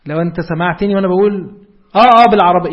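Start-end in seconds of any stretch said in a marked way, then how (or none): 2.0-2.7 "آه، آه، بالعربية" called out loudly as into a phone. Shown as a demonstration. Teacher acting a phone call for students, loud. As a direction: answer a caller on a bad line. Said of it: "يعني أنا ماسك تليفون".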